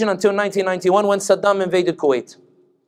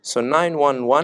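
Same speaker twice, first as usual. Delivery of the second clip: ordinary talk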